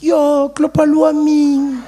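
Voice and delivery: high, loud, slow friend's guess; drawn-out mocking guess. Friend voiced.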